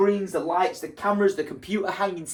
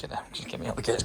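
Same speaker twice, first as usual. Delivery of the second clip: unvoiced whisper, lower; 7 semitones lower, 10 dB quieter, faster than usual. Real, not acted. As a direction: whisper a plea to escape. Wants to escape the crying scene.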